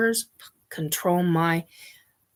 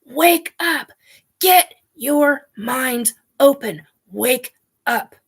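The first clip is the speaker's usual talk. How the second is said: raised, high urgent plea, easing toward the end; retold screaming; 4 semitones higher, 4 dB louder than usual. Screaming in her head at captive humans to wake up, retold yell. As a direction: re-enact a desperate yell, punching each command. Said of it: "just start screaming".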